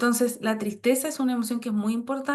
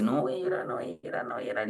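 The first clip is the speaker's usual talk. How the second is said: fading lower and softer with each repeat; repeating no voy a llorar, dropping lower and softer; holding back tears; 10 semitones lower, 6 dB quieter, faster than usual. Retold or imitated. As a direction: sink into a murmur as you swallow the tears. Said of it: "Entonces uno dice".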